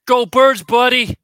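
loud, high Philly fan cheer; American accent. Pretend. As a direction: shout it like an Eagles fan. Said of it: "one liner American accent".

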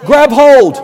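high, loud, shouted exhortation; preacher rousing the church at full force; fiery preaching. Acted. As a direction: drive it home to the congregation.

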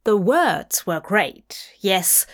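plain reading voice

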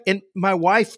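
ordinary talk, nothing marked

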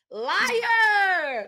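high, drawn-out accusing shout; loud mock-outraged yell. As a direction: holler it long at the screen. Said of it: "I was yelling at the T V".

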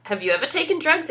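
a worried mother pressing fast; lightly voiced anxious mum; mum quote. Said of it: "And she says".

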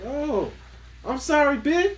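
high, loud fake-sorry apology; hammed-up sorry with a sting; mock apology. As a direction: apologise then undercut it. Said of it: "I'm like".

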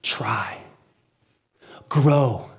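soft, slow whisper; hushed urging. Retold or imitated. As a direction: lean in and whisper the plea. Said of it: "he would say".

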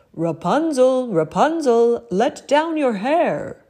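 loud, slow call up the tower; a prince calling to the window. A narrator's reading. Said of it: "he too called".

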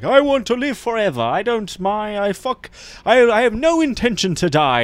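high, loud mock bravado; high mock boast. Friends boasting at the bar, high mock bravado. Quoting immortal guy's boast.

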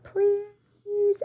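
put-on high pleading little girl; begging. A narrator's reading. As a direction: stretch out a child's please. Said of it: "Abigail begs".

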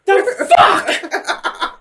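very high, loud disbelieving cry; hammed-up startled outburst; mock outraged shock. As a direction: shriek it in comic disbelief. Voicing Vader's shock at hello there.

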